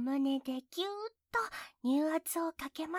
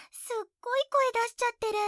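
excited teasing; 6 semitones higher, 4 dB louder, much faster than usual. Acted.

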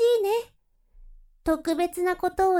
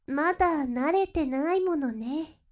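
ordinary talk

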